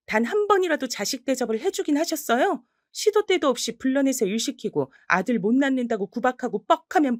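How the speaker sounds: high, indignant tirade; furious scolding. Acted. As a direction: let her have it, voice rising. Granddaughter scolds grandmother.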